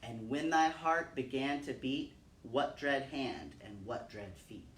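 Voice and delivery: slow, grave poem reading with long pauses; solemn recitation. Acted. Reciting William Blake for her son, solemn measured recitation. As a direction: let each question hang in the air.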